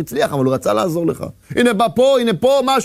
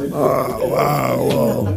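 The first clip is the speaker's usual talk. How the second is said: low fearful cries; hammed-up fearful cries; mock panic; 10 semitones lower, slower than usual. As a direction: cry out low in fear. Man panicking before lion.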